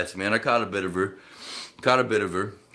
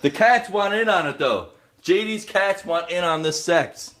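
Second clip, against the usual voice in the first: teasing high voice; 7 semitones higher, 4 dB louder than usual. Acted. Teasing friend's loud sex.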